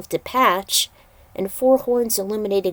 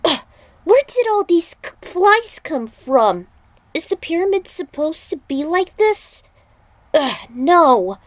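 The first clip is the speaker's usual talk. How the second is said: high, loud grossed-out complaint; disgusted; 9 semitones higher, 5 dB louder than usual. Acted. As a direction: squeal in disgust at the flies. Woman disgusted by flies.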